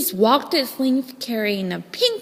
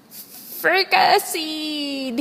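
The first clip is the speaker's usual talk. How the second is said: sinking pirate; 6 semitones higher, much slower than usual. Acted. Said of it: "he said".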